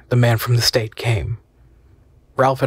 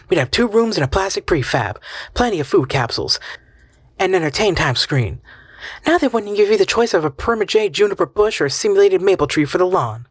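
a higher, lightly voiced wife, sarcastic; Lucy, bitter wife; 8 semitones higher than usual. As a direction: scoff at his stubbornness. Lucy argues for the state.

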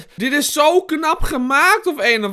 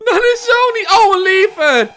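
high, loud wail of fright; panicked outcry; 12 semitones higher, 8 dB louder than usual. Real, not acted. Player startled while finding a lever, panicked outcry. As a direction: cry out, rattled by the scare.

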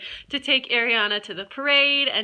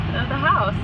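normal talking voice

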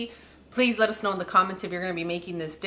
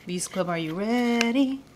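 slow host call to audience. Acted. Host calls audience, drawn out.

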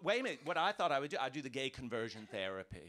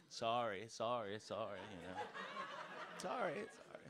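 mock British sorry; 5 semitones lower, 7 dB quieter than usual. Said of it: "I'm saying that all the time now".

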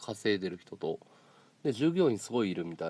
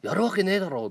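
a louder mock shout at a rich boss; imitating a yell; 6 semitones higher, 4 dB louder than usual. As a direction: bark it like the angry boss, half in jest. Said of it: "とか叫んでるから".

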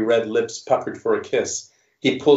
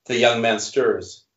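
plain reading voice

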